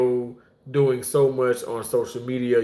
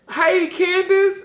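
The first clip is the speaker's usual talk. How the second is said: very high, drawn-out hail; excited greeting; 4 dB louder, slower than usual. Real, not acted. Host greeting a viewer who joined, excited. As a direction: call out the name high and slow.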